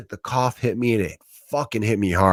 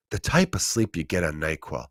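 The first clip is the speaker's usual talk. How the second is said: softer, dreamy, lingering on type; dreamy savoring; 5 semitones lower, 8 dB quieter than usual. Shown as a demonstration. Comic sighing softly over the bliss of NyQuil sleep. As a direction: sink into it, softer, like drifting off. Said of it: "just think about the type of sleep".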